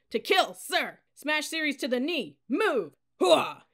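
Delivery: high, very loud military cries; shouted drill response. A narrator's reading. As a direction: shout it out in formation. Cadets answering, drill commands.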